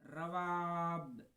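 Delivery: रवाब stretched very slowly; drawn-out name. Shown as a demonstration. Teacher modelling रवाब for students, very slowly stretched. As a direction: draw रवाब out long. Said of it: "र से बन जाएगा".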